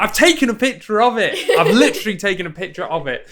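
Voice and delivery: high, loud mock exasperation; frustrated player. Player arguing with a fussy game, loud exasperation. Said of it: "it'd be like".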